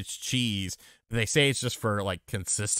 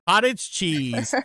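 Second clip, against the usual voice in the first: Cottage cheese stretched slow and high; drawn-out disgusted Cottage cheese; disgusted emphasis; 6 semitones higher, 4 dB louder, slower than usual. Real, not acted. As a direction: spit out Cottage cheese with loathing. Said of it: "It's disgusting".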